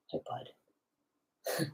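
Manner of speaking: soft whispered aside to the dog. Real, not acted. Trainer whispering a quick hello to her dog. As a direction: breathe it softly to the dog.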